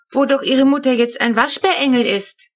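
put-on little girl adding her plea to the vet; insistent. A narrator's reading. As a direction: press the point like a determined kid. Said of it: "fügt Flo nachdrücklich hinzu".

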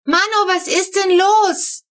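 put-on child calling out. A narrator's reading. Child bursting out in pyjamas.

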